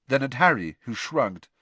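ordinary delivery, nothing marked

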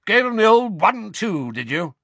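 high, booming approval; loud and hearty. A narrator's reading. Father praising his son's fighting, loud. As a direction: boom it proudly, easing off at the end. Uncle booms on to his son.

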